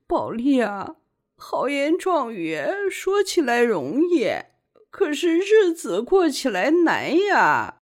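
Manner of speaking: high, crying lament; tearful. A narrator's reading. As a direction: plead with her through tears. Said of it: "不禁自己一边哭了起来".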